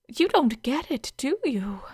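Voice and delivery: ordinary delivery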